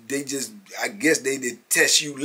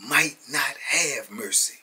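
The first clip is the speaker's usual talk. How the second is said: slow emphasis; slower than usual. Shown as a demonstration. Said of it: "What do I tell you?".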